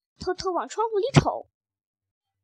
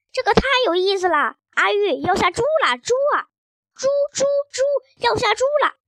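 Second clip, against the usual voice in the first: high-pitched gleeful dad; delighted; 6 semitones higher, 4 dB louder than usual. A narrator's reading. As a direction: crow with joy, climbing high on each chant. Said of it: "他却开心地笑了起来". (开心兴奋、音调高扬)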